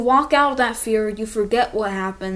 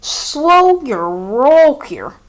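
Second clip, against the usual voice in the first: loud, higher shocked viewer slowing you down; imagined objector; 4 semitones higher, 10 dB louder, much slower than usual. Pretend. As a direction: hold up an imagined fan with a loud slow whoa. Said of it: "you may be thinking".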